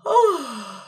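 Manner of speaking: a loud, open yawning sigh; a stretched yawn with the jaw dropped; demo yawn. Shown as a demonstration. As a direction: let out a big, loose yawn. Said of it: "So give me a little yawn".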